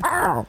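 high squeaky squirrel growl; a quick, high animal grunt; squirrel chatter. Retold or imitated. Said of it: "like fucking squirrels".

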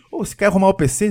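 mild mock office pest; pestering coworker. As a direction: pitch it up a little to nag for help. Acting coworker pestering player.

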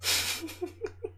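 very high breathy soft laugh. Real, not acted. High quiet laugh at a viewer's comment. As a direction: squeak out a silent laugh. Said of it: "I'm laughing so hard".

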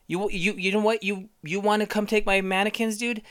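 hammed-up shouting fit over mannequins; mock furious rant. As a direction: bellow at her like she broke the law. Acted rant demanding a permit.

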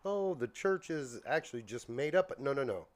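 normal talking voice

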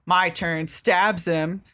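ordinary talk

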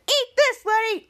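hammed-up hero taunt; mock battle cry. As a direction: fire the lasers with a taunt. Captain Man attacking.

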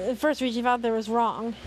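ordinary delivery, nothing marked

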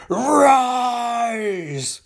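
loud, high, drawn-out command; commanding shout. Acted. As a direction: call him up from the dead. Sinestro commanding Necron.

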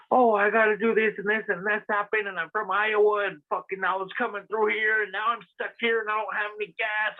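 high, far-off mock voice of an older woman begging; hammed-up old lady with a sob story. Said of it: "telling me a story, like".

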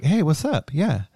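Mark Wahlberg. Acted. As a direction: rattle it off in a shaky-at-best Boston actor impression. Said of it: "I can't do a Mark Wahlberg".

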